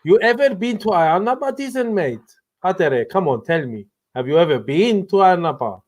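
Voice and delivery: amateur put-on Cypriot accent. Shown as a demonstration. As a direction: coax your mate in a mock Cypriot accent. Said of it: "do it in a Cypriot accent".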